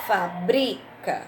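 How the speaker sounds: "Fabrica" drawn out slowly with the weight on BRI; stress on BRI. Shown as a demonstration. Teacher to pupils, slow demo of the stress on BRI. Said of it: "Qual seria a sílaba forte aí?".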